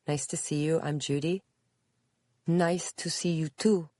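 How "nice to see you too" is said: put-on higher-pitched Spanish-accented reply. A narrator's reading. Visitor greets Judy back, in a Spanish accent. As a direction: answer politely in a Spanish accent. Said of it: "answered the woman with a Spanish accent".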